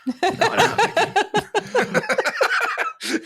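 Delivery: very high, slow, squeaky denial; feigned innocence. Retold or imitated. Kid denying he kept borrowed game, feigned innocence. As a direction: deny it in a badly faked innocent squeak.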